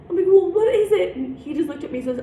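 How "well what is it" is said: high, loud demand for an answer; retold exasperation. Youth worker pressing director for the reason, exasperated. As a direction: imitate pressing someone in frustration.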